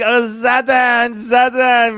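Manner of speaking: loud, drawn-out mock cheer; imitating cheering schoolboys. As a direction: echo the boys' excited cry, loudly. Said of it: "They're saying".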